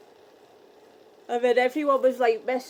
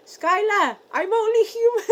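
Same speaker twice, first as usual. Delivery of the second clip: higher, louder put-on woman's voice; high voiced DJ; 9 semitones higher, 7 dB louder than usual. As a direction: play her up, high and bright.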